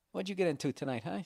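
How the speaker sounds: lightly voiced easygoing dog; casual dog voice. Dog asks its owner about his night, casual. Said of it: "just fucking regular".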